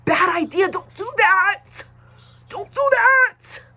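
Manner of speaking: high, loud, slow shouted warning; hammed-up yell, stretching every word; mock yelling. Scolding her viewers about sharing ID online, a hammed-up high yell. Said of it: "I didn't mean to yell at you".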